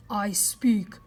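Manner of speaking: clipped, loud warrior grunt; tiny Indian grunting his reply; terse grunt. A narrator's reading. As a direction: grunt the words out, guarded. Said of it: "he grunted".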